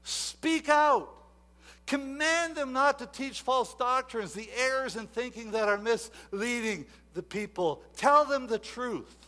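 loud, high preacher's command; booming apostle's charge; Paul's charge, voiced loud. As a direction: call it out like Paul charging Timothy. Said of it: "He says".

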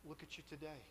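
gentle observation. Retold or imitated. Pastor to former student a year later, softly.

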